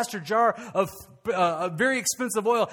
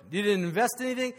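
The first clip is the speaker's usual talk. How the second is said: ordinary talk, nothing marked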